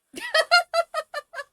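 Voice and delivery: high loud cackle; loud high laughter. Real, not acted. Laughs at the pants joke.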